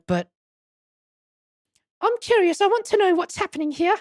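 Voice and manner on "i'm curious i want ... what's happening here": high, eager character voice; curious companion. Acted. Companion tells the hero she wants answers, high curious voice. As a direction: pitch it way up, keenly curious.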